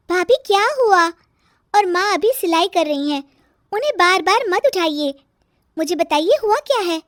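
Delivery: put-on high-pitched young woman asking what's wrong; high, protesting questions; sister-in-law, questioning.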